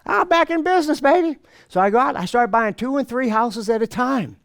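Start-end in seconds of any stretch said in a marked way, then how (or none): 0.1-1.4 high, gleeful brag; excited boast. Retold or imitated. Speaker brags to the audience about new money, gleeful. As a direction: crow about being back in the game. Celebrating getting a million dollars.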